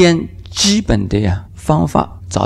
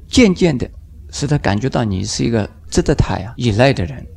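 ordinary delivery

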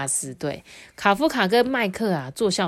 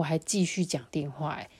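ordinary delivery

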